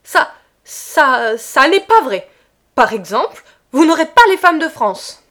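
loud, stammering angry defiance; choked with anger. A narrator's reading. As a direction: sputter it, choking with rage. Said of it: "d'une voix étranglée de colère".

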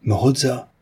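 high exclamation of surprise; astonished cry. A narrator's reading. Whole crowd reacts to the culprit's name, astonished cry. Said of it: "l'accent de la plus vive surprise".

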